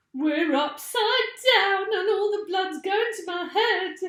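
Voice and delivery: high, loud panicked scream. A narrator's reading. Missus Twit panics about the blood going to her head, high loud scream. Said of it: "screamed Missus Twit".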